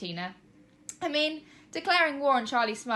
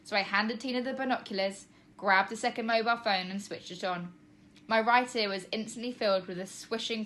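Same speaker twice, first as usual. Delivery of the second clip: usual speaking voice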